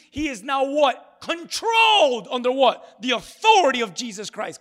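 rising preacher's call; preaching climax. Real, not acted. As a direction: drive each question home from the pulpit.

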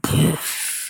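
airy, high "poof" sound effect; imitated blast of flame. Telling how she turned the gas on, imitated whoosh. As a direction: puff out a burst of igniting gas. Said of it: "it, like, blew out".

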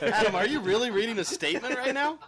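high, loud incredulous laugh-talk; can't believe it, laughing; laughing disbelief. Real, not acted. Disbelief at Adam reading statement.

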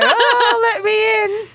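high mock wail through laughter; pleading to get in. Host acting a man locked out at the window, comic pleading.

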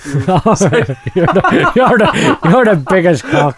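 giggling as he tries to get the line out; words broken up by laughter; laughing through it. Acted. Pat laughing while praising Peter.

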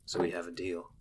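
soft, low-key boss voice; quiet NPC voice. Acted. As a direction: say it quietly, almost a murmur. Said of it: "he looks at you and he says".

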